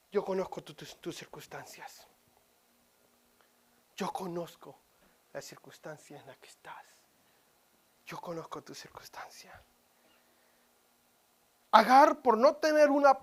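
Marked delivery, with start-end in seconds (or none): 0.1-4.8 soft, low and intimate; hushed God voice. Acted. Preacher voicing God softly to the grieving listener. 5.3-9.6 breathy whisper of comfort. Acted. God whispering to the hurting listener, tender whisper.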